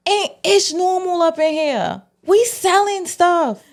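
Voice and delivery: high-pitched mock dealer quote; playful high retelling of the dealers; lightly voiced street dealers. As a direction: imitate dealers, pitched well up.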